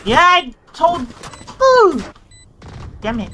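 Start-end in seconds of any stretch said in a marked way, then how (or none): 0.0-2.0 high loud startled cry; alarmed yelp. Real, not acted. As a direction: yelp as you get hit. Getting shot in game.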